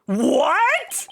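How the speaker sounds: high startled squeak; squeaky surprised what. Acted. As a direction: yelp it out in shock. Phoenix reacts, squeaky high.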